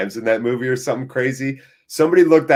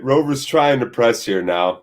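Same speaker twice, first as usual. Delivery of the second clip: ordinary delivery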